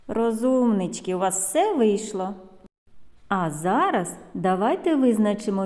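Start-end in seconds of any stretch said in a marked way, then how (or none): none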